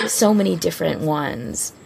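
normal talking voice

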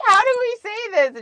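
pitched high, mock call across the room; imitating yelling a question at a roommate; retold yell to roommate. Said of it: "we started yelling at her".

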